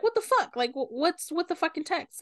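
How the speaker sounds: high-pitched retold indignation; mock pissed-off. Podcaster retelling Apollo confronting him about texts, mock annoyance. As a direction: imitate his exasperated demand, starting very high. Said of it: "Apollo's pissed".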